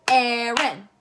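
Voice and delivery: Aaron in two loud beats; clapped-out syllables. Shown as a demonstration. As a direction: split Aaron as if clapping each half. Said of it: "let's do it clapping".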